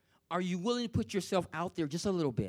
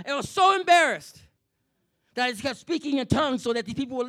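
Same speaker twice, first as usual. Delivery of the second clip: louder, driving sermon build; preaching at full force; 3 semitones higher, 6 dB louder than usual. Acted. Preacher confessing to the congregation, loud and building. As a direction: preach it hard, rising to a peak on embarrassed.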